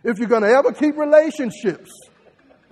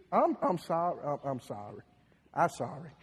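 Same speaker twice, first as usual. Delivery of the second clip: meek apology; 7 semitones lower, 11 dB quieter than usual. Retold or imitated. Acted apology in quarrel.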